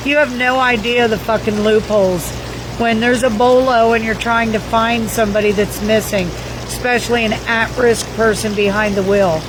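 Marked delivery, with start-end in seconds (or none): none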